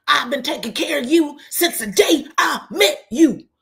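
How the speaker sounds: loud, high imitation of a husband's rant; angry outburst. Acts out Greg's outburst at Quad.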